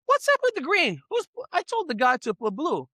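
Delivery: high-pitched, indignant disbelief; mock outrage at the green wall; husband seeing the green wall, shocked and high.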